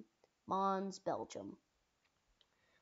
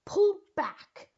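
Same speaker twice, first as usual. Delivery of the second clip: high, very loud retreat command; shouted order; 12 semitones higher, 11 dB louder than usual. Acted. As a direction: yell the order to the troops. Officer orders retreat in acted battle.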